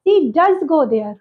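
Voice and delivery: does pressed in a slow She does go there; slowly, to convince; emphatic does. Shown as a demonstration. Convincing someone that she goes, stressing does. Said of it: "विश्वास दिलाया जा रहा है".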